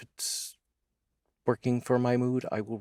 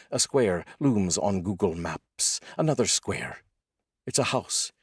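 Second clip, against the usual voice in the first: poem reading. Acted. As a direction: let each grim image land in the silence. Reads a translated war poem.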